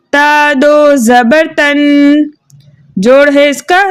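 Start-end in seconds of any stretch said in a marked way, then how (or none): 0.1-2.3 slow, level chant with stretched syllables; spelling-out recitation. Shown as a demonstration. Teacher reads spelling and blend together for pupils, slow chanted drill. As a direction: draw out each syllable and hum the final n. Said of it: "जोड़ो रवा के साथ पढ़ते हैं".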